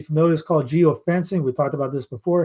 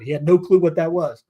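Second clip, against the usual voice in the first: normal talking voice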